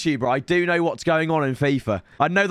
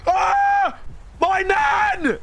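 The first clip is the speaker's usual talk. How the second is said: excited screaming; 14 semitones higher, much slower than usual. Real, not acted. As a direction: shriek with joy at the pulls. Shouting at back to back pulls.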